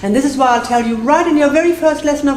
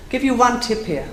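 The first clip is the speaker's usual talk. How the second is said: ordinary talk, nothing marked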